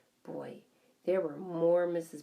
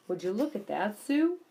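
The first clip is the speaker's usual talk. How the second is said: louder, rising on Sue; surprised; 4 semitones higher, 7 dB louder than usual. A narrator's reading. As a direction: catch her attention, amazed. Husband points at spinning hamster.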